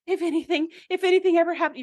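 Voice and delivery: overly heartfelt, a touch boozy; mock tipsy relative. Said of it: "When they're like".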